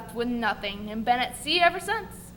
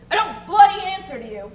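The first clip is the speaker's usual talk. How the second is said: louder with bloody punched hard; angry defiance; 5 semitones higher, 8 dB louder than usual. Acted. Jenkins to the bosun, a louder angry refusal. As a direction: hit bloody hard and raise the voice.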